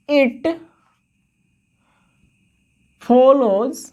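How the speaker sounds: very slow dictation, long gaps between phrases. Real, not acted. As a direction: draw each phrase out for note-taking. Teacher dictating a line to write. (शब्द खींचकर धीरे-धीरे लिखवाते हुए)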